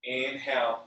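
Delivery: ordinary talk, nothing marked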